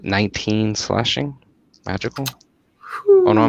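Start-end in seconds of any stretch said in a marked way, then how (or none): 3.1-3.5 sudden high "oh no" in alarm; startled yelp. Real, not acted. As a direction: jump up in pitch, caught out. Player catching own dice error.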